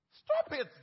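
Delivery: loud, high stop it; sudden command. Real, not acted. Pastor orders husbands in the congregation, loud and high. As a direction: bark it out at the congregation.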